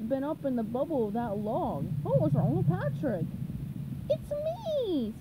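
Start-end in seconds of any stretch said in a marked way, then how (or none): 4.1-5.1 very high, crying out; squeaky-high cartoon exclamation; SpongeBob. A narrator's reading. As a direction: pipe it up excitedly. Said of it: "SpongeBob cried".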